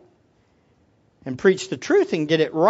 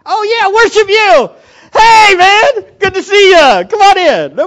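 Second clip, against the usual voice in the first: much higher, louder, hammed-up friendliness; fake cheerful greeting; 10 semitones higher, 12 dB louder than usual. Greeting people at church with fake cheer. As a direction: overdo the happy church welcome. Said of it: "mock cheerful churchgoer".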